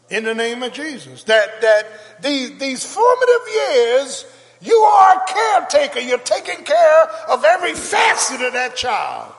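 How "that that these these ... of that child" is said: rising to a full preaching shout, high and loud. Real, not acted. Pastor preaching to parents, building to a loud shout. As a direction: build up and belt it at the congregation.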